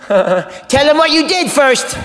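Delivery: high, slow, insistent mock demand; scolding girlfriend. Girlfriend makes comic confess to his hero, high scolding demand. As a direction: push him to confess, voice pitched high.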